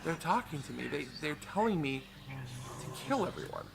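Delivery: soft, creepy monotone threat; hushed and unsettling; quiet eerie voice. Retold or imitated. Friend's creepy confession.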